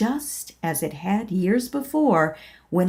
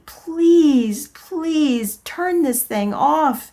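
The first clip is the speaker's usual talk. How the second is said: desperate prayer; 7 semitones higher than usual. Acted. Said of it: "I prayed".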